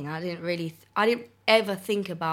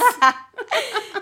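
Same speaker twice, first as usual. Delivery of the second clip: loud pitched-up laugh; high loud laugh; 10 dB louder than usual. Real, not acted. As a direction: burst out laughing. Laughs loudly.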